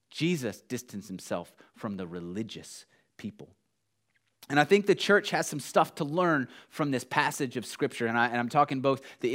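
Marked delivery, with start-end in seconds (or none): none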